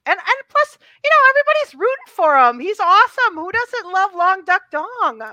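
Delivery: high, loud enthusiasm; excited gushing. Real, not acted. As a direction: champion a favourite character with real excitement. Gushing over a favourite character.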